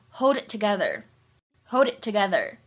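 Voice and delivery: "hold it together" linked with the t of "it" unreleased; smooth demo of "hold it together"; linked, dropped t. Shown as a demonstration. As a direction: blend hold it together, swallow the t. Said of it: "完全失去爆破".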